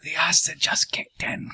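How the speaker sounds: whispered aside. Real, not acted. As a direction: whisper it to the table. Aside about character.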